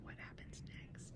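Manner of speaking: hushed whisper of suspense; teacher whispering to her students to build suspense. Real, not acted. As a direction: whisper it to draw them in.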